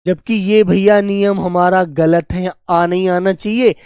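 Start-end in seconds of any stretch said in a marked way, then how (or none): none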